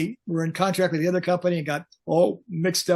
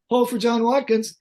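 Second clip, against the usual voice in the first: higher-pitched imitation of a wife on the phone; retold phone announcement; 6 semitones higher, 4 dB louder than usual. Wife puts the star on hold, mimicked secretary voice.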